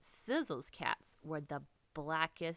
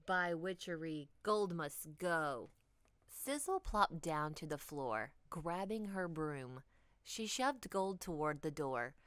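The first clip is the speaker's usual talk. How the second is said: usual speaking voice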